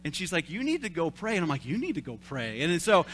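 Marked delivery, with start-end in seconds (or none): 0.5-1.4 higher, rising insistence on need; a lightly voiced wife urging him; wife's firm advice. Wife sending her husband off to pray, higher insistent. 1.6-2.6 flat sulky parroting, need falling; mocking echo. Husband parroting his wife, flat sulky mockery. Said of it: "reverted back to, like, a three-year-old".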